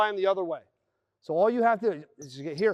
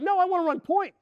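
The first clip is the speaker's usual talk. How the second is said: higher, petulant mock reply; stubborn ball handler; 11 semitones higher than usual. Ball handler refusing the post feed, high and petulant. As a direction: whine that you want your play. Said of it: "And you're like".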